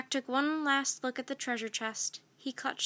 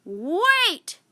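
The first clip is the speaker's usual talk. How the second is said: loud high desperate yell; captain cries out to his fleeing men; cried; 7 semitones higher, 10 dB louder than usual. A narrator's reading. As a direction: yell for them to wait. Captain chasing the boat.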